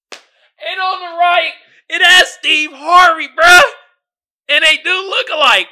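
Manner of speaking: high, loud, half-laughing shriek; shrieking disbelief. Real, not acted. Describing the Steve Harvey look-alike meme in shrieking disbelief. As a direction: cry it out in amazed hysterics.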